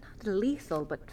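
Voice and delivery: absorbed, mutter it under your breath; muttering to herself. Acted. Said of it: "Talking to yourself or Frankie?".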